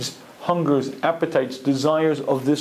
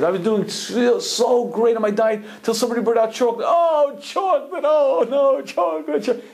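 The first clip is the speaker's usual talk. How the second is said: high wailing woman; 9 semitones higher than usual. Retold or imitated. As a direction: wail like a desperate dieter. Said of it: "Another one says".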